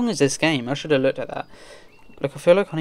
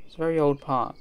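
normal talking voice

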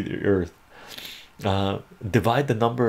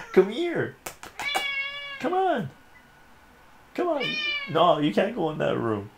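much higher coaxing cat voice; high pet voice. Real, not acted. Owner calling the cat away from the room in high coax.

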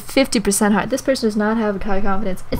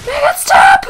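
very high, loud mock-desperate scream; high screamed begging; frantic plea; 13 semitones higher, 8 dB louder than usual. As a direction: shriek the plea at full volume. Pleading louder during game mishap.

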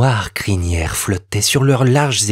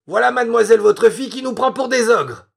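much higher, laughing report; amused soldier; 10 semitones higher than usual. A narrator's reading. Soldier amused, telling the captain his daughter thinks them ogres, much higher. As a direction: share the joke with the captain.

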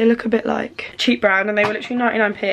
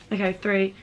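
usual speaking voice

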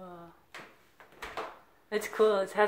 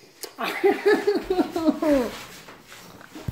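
being tickled; 10 semitones higher, 5 dB louder than usual. Real, not acted. Being tickled, laughing hard.

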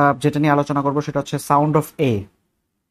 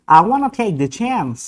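slow, higher American English, wanna and a flat a; American reading; American accent; 6 semitones higher, much slower than usual. Shown as a demonstration. Teacher says it the American way.

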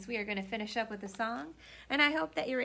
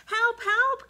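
high, loud panicked cry for help; frightened cry; 13 semitones higher, 11 dB louder than usual. Acted. Frightened rabbit crying for help to friends. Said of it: "cried Rabbit".

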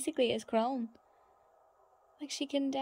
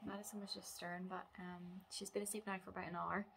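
quiet; 10 dB quieter than usual. Real, not acted. Baby asleep nearby.

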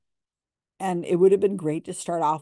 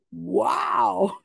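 mock wow; 3 semitones higher than usual. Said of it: "And Cindy says".

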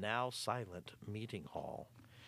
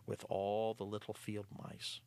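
plain reading voice

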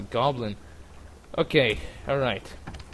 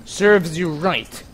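triumphant; 5 dB louder, much slower than usual. Real, not acted. After killing target.

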